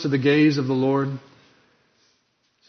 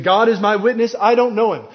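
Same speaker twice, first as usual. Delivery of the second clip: raised, higher, louder oath; swearing an oath; 7 semitones higher, 4 dB louder than usual. Acted. Peter swearing an oath before the crowd, raised. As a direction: swear it, voice lifted. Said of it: "by swearing, he is saying".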